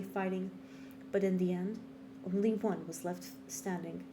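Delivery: hushed, solemn monologue reading; dramatic monologue. Acted. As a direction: read it like a brooding game intro. Reads a game monologue aloud.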